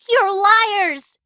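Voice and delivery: high, loud furious shout; girl shouting at both parents. A narrator's reading. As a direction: scream it at them. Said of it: "Clara shouted".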